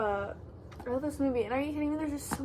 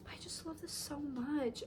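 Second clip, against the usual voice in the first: whisper; 4 semitones higher, 12 dB quieter than usual. Real, not acted. Admiring bag interior.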